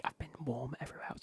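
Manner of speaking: ordinary talk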